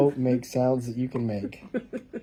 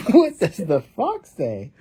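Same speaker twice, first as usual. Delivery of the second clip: louder question through laughter; laughing; 5 semitones higher, 6 dB louder than usual. Real, not acted. Laughs while reading.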